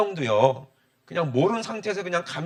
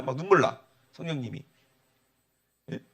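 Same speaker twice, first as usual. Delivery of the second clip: ordinary talk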